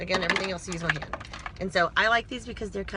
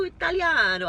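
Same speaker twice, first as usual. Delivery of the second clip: playful mock Italian accent, higher and louder; 9 semitones higher, 5 dB louder than usual. Vlogger slips into her joke Italian accent for a word. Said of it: "I sometimes do Italiano accent".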